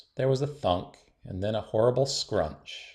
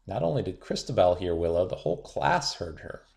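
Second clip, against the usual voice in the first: usual speaking voice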